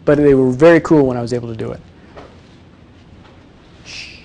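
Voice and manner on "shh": hushing shh. Real, not acted. Hushing someone in the room.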